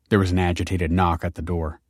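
ordinary delivery

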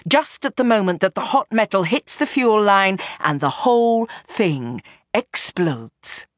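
usual speaking voice

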